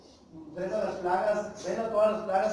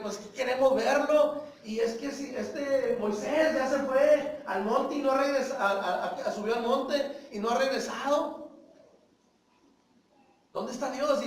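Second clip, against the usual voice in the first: higher, pushy complaint with a laugh slipping in; grumbling people; 5 semitones higher, 4 dB louder than usual. Acted. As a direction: play the impatient crowd. Israelites complain about Moses.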